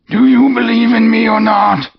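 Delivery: very high, loud otherworldly wail; wailing ghost. Acted. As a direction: demand an answer from beyond. Ghost voiced by narrator.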